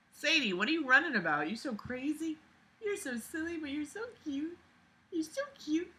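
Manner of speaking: high, soft cooing at the pet; soft, high pet talk. Real, not acted. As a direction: coo over a cute pet. Talking to her pet.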